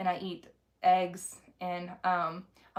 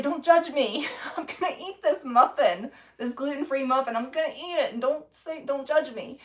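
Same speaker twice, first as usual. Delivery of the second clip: teasing retort to a disapproving husband, higher and laughing; mock defiant; 5 semitones higher than usual. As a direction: brush off the husband's judgment, laughing. Retold defiant reply to husband.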